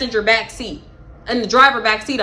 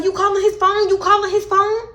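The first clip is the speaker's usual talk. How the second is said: imitating the girl; 9 semitones higher than usual. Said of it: "She was like".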